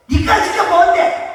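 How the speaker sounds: high, loud dismissive retort; scornful challenge. Acted. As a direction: look down on them and challenge loudly. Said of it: "이렇게 무시하는 데서 보는 거예요".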